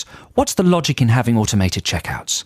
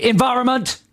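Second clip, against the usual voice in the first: shouted word; 12 semitones higher than usual. Retold or imitated. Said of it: "I like to try and test it".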